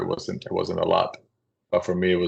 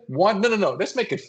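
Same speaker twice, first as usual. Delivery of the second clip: higher, gleeful taunting; mock teasing coworker; 11 semitones higher than usual. Coworker ordering more on the kid's check, high gleeful tease. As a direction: pile it on with glee.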